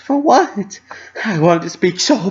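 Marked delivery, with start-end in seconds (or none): 0.0-0.8 high, light puzzled question; a bubbly pony asking innocently; Pinkie's bright voice. A narrator's reading. Pinkie asking Dash why she apologizes, high and bright.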